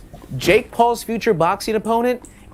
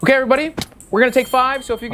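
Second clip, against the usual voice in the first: director calling out; 7 semitones higher, much faster than usual. Pretend. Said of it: "And cut".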